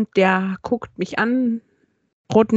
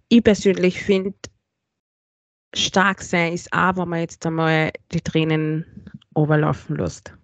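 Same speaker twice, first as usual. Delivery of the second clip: earnest life lesson; 4 semitones lower than usual. Acted. Gives listeners a life lesson.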